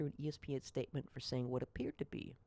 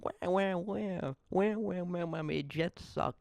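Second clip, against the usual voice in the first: higher-pitched mocking wah wah, whining about the Jets; whiny crybaby mock; 5 semitones higher, slower than usual. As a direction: whine like a sulking baby fan. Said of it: "Mike Greenberg is a whiny little".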